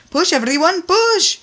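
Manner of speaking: very high, loud cheer on; rousing shout. A narrator's reading. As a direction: urge them all to push. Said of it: "encouraged O J".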